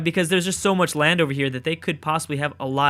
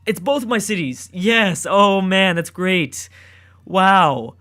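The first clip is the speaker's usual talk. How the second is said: loud, higher-pitched happy exclamations; excited delight; 5 semitones higher, 6 dB louder, much slower than usual. Real, not acted. Player cheering a win for both his cities, excited delight. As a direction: burst out delighted at good news.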